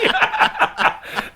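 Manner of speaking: high-pitched burst of laughter; hearty laugh. Real, not acted. Comic laughing at his own bed joke, hearty laugh. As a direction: crack up at your own joke.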